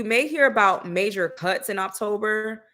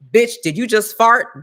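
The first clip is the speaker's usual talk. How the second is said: mock enforcer; 9 dB louder than usual. Mock police enforcing fart law.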